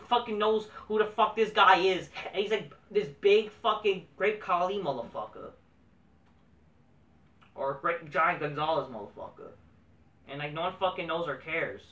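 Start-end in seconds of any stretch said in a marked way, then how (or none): none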